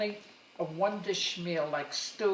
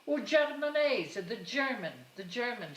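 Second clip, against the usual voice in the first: put-on mother's Italian accent; 5 semitones higher than usual. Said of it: "she also liked".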